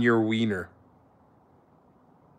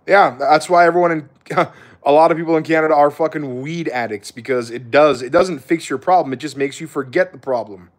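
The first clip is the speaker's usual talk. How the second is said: usual speaking voice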